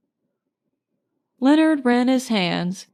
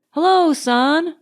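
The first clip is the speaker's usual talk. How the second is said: bright, higher, louder greeting to the sky; cried out; 7 semitones higher, 4 dB louder than usual. A narrator's reading. Marjorie greeting the sun on the beach, joyful cry. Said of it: "cried Marjorie".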